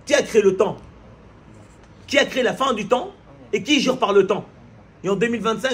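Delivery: impassioned Friday sermon at full force; fiery preaching. Acted. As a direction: preach it hard to the congregation. Preacher exhorts the congregation.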